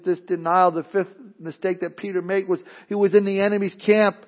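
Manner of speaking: normal talking voice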